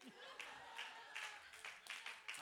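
high, breathless, wheezing laugh. Real, not acted. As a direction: laugh yourself silly at the punchline. Laughing at the punchline.

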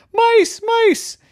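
very high shrill cry of alarm; alarmed shout. Acted. Cat friends spot the mice and shout in a high alarm. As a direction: yell it out like a sighting.

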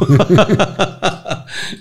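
sudden high burst of laughter; loud laugh. Real, not acted. Husband joking about his own insecurity, loud laugh.

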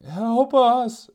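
high, loud, far-off plea; distant cry for help. Retold or imitated. Stranded family calling help from afar. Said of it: "But the voice even".

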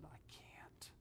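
broken, whispered I can't; barely a breath, choking back tears; weeping whisper. Retold or imitated. Husband at wife's deathbed, whispering through tears. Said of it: "he began to weep".

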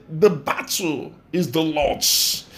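slow, drawn-out scripture quote; slow solemn quotation. Acted. Preacher quoting the Lord's words to the congregation, slow and solemn. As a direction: let each word of the verse sink in slowly.

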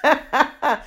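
high, quick words through laughter; amused, breaking into a loud laugh. Real, not acted. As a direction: laugh at yourself as you say it. Laughing at own crying story.

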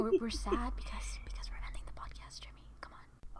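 hushed fake-sad whisper; mock sad whisper. Host whispers to cohost that they're sad, mock sad whisper.

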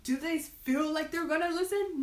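high loud ranting gnome; angry gnome. Acted. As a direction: rant about greedy people. Gnome rants again.

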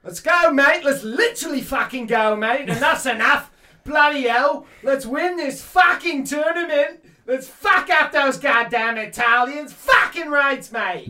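loud, high hammed-up Australian bloke; mock Australian accent. Friend cheering England in a mock Australian accent. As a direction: shout it like a rowdy Australian footy fan. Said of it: "That was Australian".

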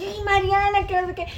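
high mock-mortified voice; fretting dad. Dad reacting to his daughter's blunder, mock scolding. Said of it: "Y su papá casi".

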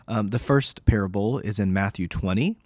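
ordinary delivery

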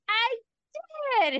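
very high, drawn-out self-answer; mock realization. Reviewer remembering she saw the movie, high mock realization. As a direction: squeal the realization playfully, stretching it out. Acting out her realization, then laughing.

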